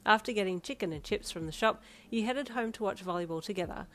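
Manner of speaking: ordinary delivery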